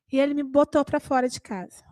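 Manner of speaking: ordinary talk, nothing marked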